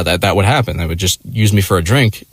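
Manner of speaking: usual speaking voice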